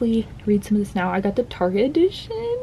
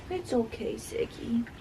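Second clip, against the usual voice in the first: softer, hushed reassurance to the dog; hushed soothing; 2 semitones higher, 9 dB quieter, slower than usual. Real, not acted. Owner hushing her scared dog Ziggy, soft murmur. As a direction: murmur comfort to a scared pup.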